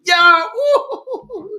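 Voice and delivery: high laughing woo, swept up; emotional whoop. Real, not acted. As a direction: let out a joyful overwhelmed whoop. Overwhelmed by memory of song.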